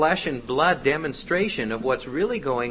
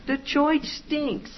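high, slow, goofy blurting; bumbling junior demon; 12 semitones higher, slower than usual. Acted. Rookie demon tries to be subtle, bumbling. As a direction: drag it out high like a clumsy rookie. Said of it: "Junior goes".

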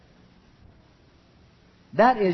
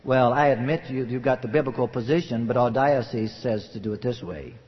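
imitated pastor, low-pitched admission; conceding pastor; 7 semitones lower than usual. Pastor admitting the point to a church member, low and resigned.

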